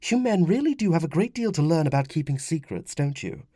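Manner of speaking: high woman's voice, amused; teasing wife. Acted. Wife chides husband about secrets, teasing. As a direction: chide men with gentle mockery.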